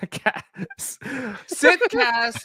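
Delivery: breathy laugh through word. Real, not acted. Laughs over own slip.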